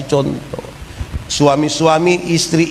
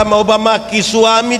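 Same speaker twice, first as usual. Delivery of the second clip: ordinary delivery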